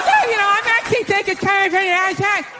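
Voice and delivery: the plumber telling the wife he has it handled; mock reassuring plumber. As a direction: say it politely through a laugh. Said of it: "And he goes".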